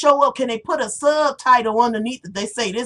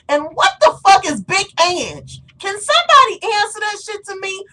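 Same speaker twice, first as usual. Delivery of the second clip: higher, louder exasperated rant; 10 semitones higher, 5 dB louder than usual. Real, not acted. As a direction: demand an answer, loud and indignant. Ranting at Mob Wives.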